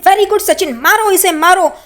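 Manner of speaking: high, acted cheering on; egging on. [name] cheers Sachin on to hit, acted excited urging.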